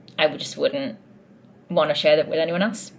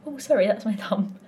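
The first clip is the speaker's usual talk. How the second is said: normal talking voice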